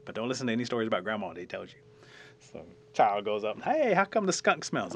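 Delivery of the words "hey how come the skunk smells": high, loud calling out; higher, louder, attention-getting question; child's eager question. Acted. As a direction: pipe up like a curious child.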